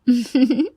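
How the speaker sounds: short flirty giggle; coy giggle.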